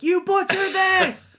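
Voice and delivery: high, slow, outraged cry; an Irish person scolding her for butchering the language; indignant Irish critic. Retold or imitated. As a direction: accuse them of mangling it. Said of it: "they'd be like".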